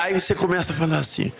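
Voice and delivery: usual speaking voice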